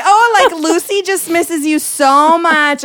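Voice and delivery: high, loud, stretched-out mock gushing; gushing mom. Daughter imitates mom talking about the dog, gushing mom voice. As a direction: imitate mom laying it on thick.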